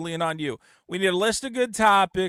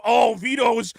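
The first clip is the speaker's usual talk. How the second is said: loud, high, drawn-out mock accusation; exaggerated high jeering impression; mocking co-host; 7 semitones higher, 5 dB louder, much slower than usual. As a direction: imitate a heckling co-host, slowly and scornfully. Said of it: "and you go".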